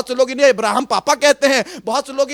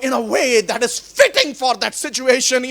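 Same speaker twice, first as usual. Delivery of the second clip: normal talking voice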